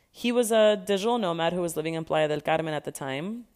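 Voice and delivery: ordinary talk, nothing marked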